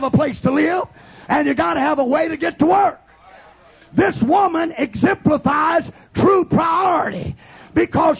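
impassioned, shouted revival sermon; full-force preaching. Acted. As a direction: preach it hard to a shouting congregation.

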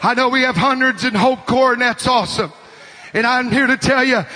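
ordinary talk, nothing marked

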